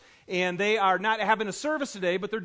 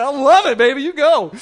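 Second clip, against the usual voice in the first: loud, higher, laughing approval; delighted cheer; 6 semitones higher, 12 dB louder than usual. Retold or imitated. Dad cheering on his bold little girl, delighted.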